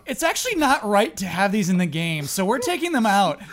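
high, loud hammed-up developer statement; mock developer voice. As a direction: ham up a sincere developer. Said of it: "Basically, they're saying".

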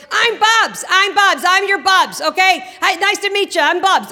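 loud Bubs greeting. Retold or imitated. Grandma introducing herself as Bubs to the newborn, loud and high. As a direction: announce yourself to the baby at full volume. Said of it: "when did she get so loud".

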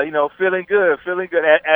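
ordinary delivery

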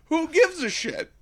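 high, breezy who gives a shit; mock movie voice. The movie shrugging off the drawing, high and breezy. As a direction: toss it off in a high, airy shrug. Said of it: "the movie just kinda plays it off like".

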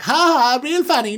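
high, loud sarcastic ha ha; mock laugh. Manager answers his brothers' threat, loudly sarcastic. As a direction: throw the joke back at them. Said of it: "He's like".

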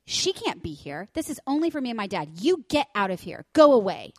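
imitating a bossy kid, rising to a loud shout; mock mean child shooing someone away; bratty little girl. Mom mimicking the park bully to her cohost, bratty shout.

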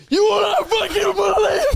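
high laughing shout; incredulous shout. Retold or imitated. Friend on the phone shouting about the windshield. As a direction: yell it in disbelief. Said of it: "He's like".

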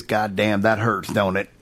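low, soft, drawled quote; hint of Sam Elliott's gravel; lightly imitating Sam Elliott. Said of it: "he looks at him and goes".

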